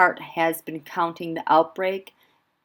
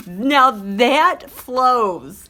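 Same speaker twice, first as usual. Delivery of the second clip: very slow, louder, savoring the rhyme; relishing how it flows; drawn out; 6 semitones higher, 6 dB louder, much slower than usual. Real, not acted. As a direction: stretch every word out.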